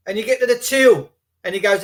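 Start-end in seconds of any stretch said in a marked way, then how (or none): none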